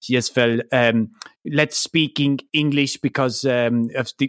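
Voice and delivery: low, halting mock German accent; attempted German accent. Podcaster retelling the Nazi's line to Dave, attempted German accent. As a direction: imitate a film villain with a dodgy German accent. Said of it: "try to do a German accent".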